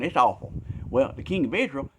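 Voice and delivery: ordinary talk, nothing marked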